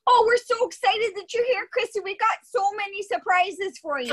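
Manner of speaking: very high, bright gushing party voice; over-the-top excitement. Shown as a demonstration. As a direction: gush like an overexcited Canadian actor. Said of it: "play upbeat and excited".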